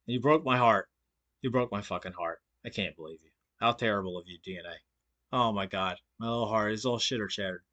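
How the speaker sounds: fading to a low, soft fake sob voice; mock heartbroken. Streamer mimics a rival's heartbreak, sinking soft and low. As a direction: pout it out like a crushed fan. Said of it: "It did sound like bitter resentment".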